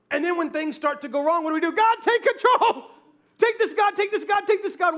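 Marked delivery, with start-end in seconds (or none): 1.7-5.0 loud, high, hammed-up prayer; desperate plea. Believer begs God when things go wrong, desperate. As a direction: cry out to heaven in mock panic.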